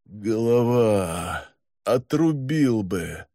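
slow, weary moan. Acted. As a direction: moan it out in misery. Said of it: "сумел лишь простонать". (слабый стон)

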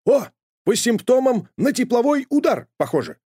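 high, quick know-it-all dog; high eager puppy voice; eager dog Sharik. A narrator's reading. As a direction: blurt the diagnosis brightly. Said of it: "быстро нашёл Шарик".

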